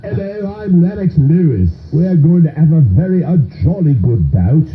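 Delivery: high, slow hammed-up British accent; mock a cheery British boxer, jolly and polite; jokey Cockney-ish British accent with a laugh. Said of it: "He sounds like [name] when they interviewed him".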